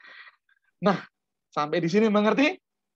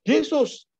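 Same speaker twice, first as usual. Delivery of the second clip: mock call of the name; 9 semitones higher, 3 dB louder than usual. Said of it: "saya malah dipanggil Yesus".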